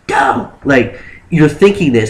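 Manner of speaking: ordinary talk, nothing marked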